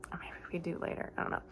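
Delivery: quick unvoiced whispered aside; whispered afterthought. Real, not acted. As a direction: mutter it under your breath, fast. Unsure aside to herself.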